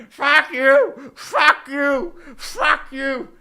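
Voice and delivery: hammed-up yell of furious fans; imitating furious Flyers fans screaming; angry fans. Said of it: "all the Flyers fans are all pissed, like".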